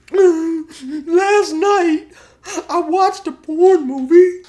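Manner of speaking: loud, squeaky mock sobbing; Josh. Josh confessing to his buddy, exaggerated high weeping. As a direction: confess through hammed-up falsetto tears. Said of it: "Josh is crying and stuff".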